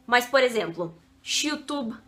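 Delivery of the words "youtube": "YouTube" com Y chiado, "chutube"; chiado argentino. Shown as a demonstration. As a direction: comece "YouTube" com som de "ch". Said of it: "eles falam muito chiado". ("YouTube" chiado, à argentina)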